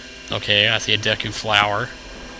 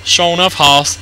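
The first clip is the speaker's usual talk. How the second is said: folksy quip; 6 semitones higher, 5 dB louder, slower than usual. Pretend.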